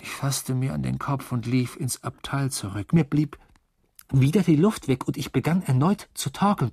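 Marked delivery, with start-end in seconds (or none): none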